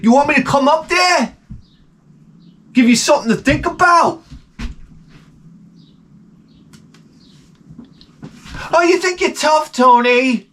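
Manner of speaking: high mock yelling, back and forth; mock shouting couple.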